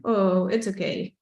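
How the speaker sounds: ordinary talk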